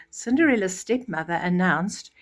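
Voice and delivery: ordinary delivery, nothing marked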